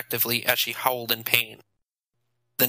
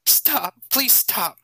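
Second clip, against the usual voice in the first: put-on higher, tearful pleading girl; crying plea; 10 semitones higher, much slower than usual. A narrator's reading. Girl at the back begs Nathan to stop, crying. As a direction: beg him to stop through tears. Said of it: "a crying voice said".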